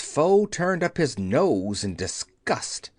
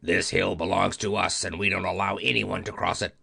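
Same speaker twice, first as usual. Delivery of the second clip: low gruff hammerhead voice, faster at the end; rough voice; 5 semitones lower, faster than usual. Acted. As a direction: warn off strangers in a low growl. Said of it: "and the same voice said".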